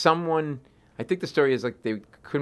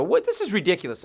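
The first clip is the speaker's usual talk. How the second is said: exasperated snap; 7 semitones higher than usual. Retold or imitated. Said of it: "And I snap".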